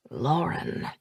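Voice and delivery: soft, hushed, reverent name. Acted. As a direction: say her name like a treasure. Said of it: "He said her name with reverence".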